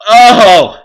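loud, high groan of pain. Real, not acted. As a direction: let out a big burning "oh". Mouth burning from hot sauce.

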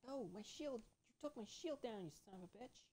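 high, exasperated protest; real indignant outburst. Real, not acted. Player cursing enemy for downing his shield, high and indignant.

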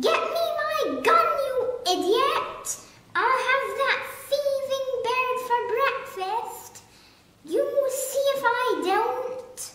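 very high, loud, fast furious yelling; enraged shout. Acted. Duke raging at the gardener for his gun, very high and loud. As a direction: scream at the idiot gardener in rage. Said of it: "the Duke was shouting".

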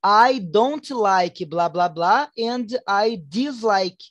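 very slow, deliberate example; slow model sentence. Shown as a demonstration. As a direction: lay out the options slowly. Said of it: "you can say". (exemplo bem devagar)

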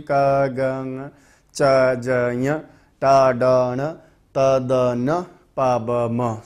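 क, ग, ङ each said with little breath; light unaspirated letters. Shown as a demonstration. Teacher reciting the unaspirated letters lightly. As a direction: read them lightly, little air. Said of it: "कम जोर लगाना पड़ रहा है".

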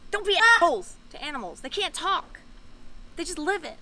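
angry outburst. Real, not acted. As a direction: snap it out, fed up with cruel people. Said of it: "it makes me so mad".

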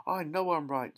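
high-pitched, huffy retort; indignant insistence. A narrator's reading. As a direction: insist it in a mother's huff. Indignant mother insisting in quarrel.

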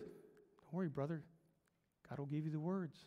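low, hushed, soothing voice; calm reassurance. Acted. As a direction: murmur comfort like an old preacher. Old preacher calmly reassures the young.

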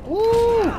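loud high yelp of surprise; sudden high gamer yelp; excited yelp. Real, not acted. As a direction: yelp as the hit lands. Hit in a game fight.

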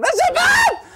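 very high, loud mock scream with laughter. Pregnant wife as the pots fall, screaming. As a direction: wail over the ruined meal. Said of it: "שהיא צועקת".

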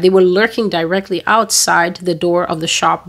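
usual speaking voice

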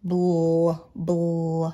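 bl sounded out and held; blend sound bl. Shown as a demonstration. Teacher sounding out a blend for pupils, bl held. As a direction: draw out the l in bl. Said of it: "What two letters make my blend?".

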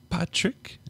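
high tearful sigh. Acted. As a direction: utter the name through tears. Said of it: "she uttered among the sighs".

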